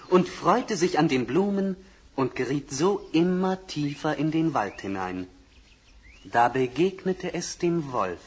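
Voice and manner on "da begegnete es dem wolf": ordinary delivery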